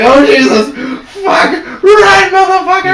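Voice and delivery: high, loud yelling in a panic; yelling while reliving a horror scene; terrified shout. Retold or imitated. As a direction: scream like you're fleeing. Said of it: "I'm like".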